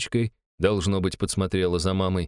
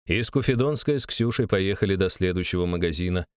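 plain reading voice

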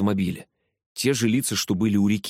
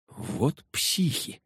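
soft, slow breathed aside; hushed, drawn-out private remark; inner thought; 7 semitones higher, 5 dB quieter, much slower than usual. A narrator's reading. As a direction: think it to yourself, almost whispered. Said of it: "подумал я".

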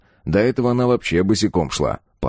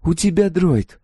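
put-on high-pitched, assured girl's voice; confident girl; 10 semitones higher than usual. A narrator's reading. Girl guessing the mercenary's secret, confident. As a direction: state it as a sure young woman. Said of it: "уверенно сказала".

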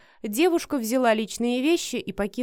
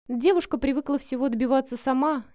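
ordinary delivery, nothing marked

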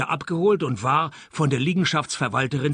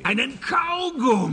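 much higher, a startled disbelieving repeat; incredulous echo; 10 semitones higher than usual. Acted. The detective echoes the chief's clue, startled and disbelieving.

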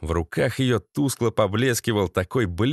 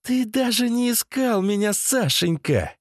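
reproachful woman; 11 semitones higher than usual. A narrator's reading. Masha reproaching Sashenka for never searching, high voice. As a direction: whine the reproach, pitched up.

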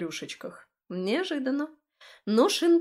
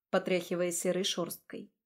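ordinary delivery, nothing marked

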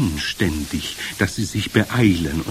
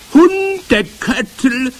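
long drawn-out high shriek; screech; 8 dB louder, much slower than usual. A narrator's reading. Witch announces the foul smell she found, screeching. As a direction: screech it slowly, stretching each syllable. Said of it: "kreischte sie".

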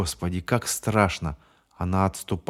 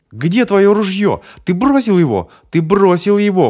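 triumphant accusation; 11 semitones higher, 9 dB louder than usual. Acted. Bonnie accuses James of dropping his gun, triumphant shout. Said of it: "победно возвестила она".

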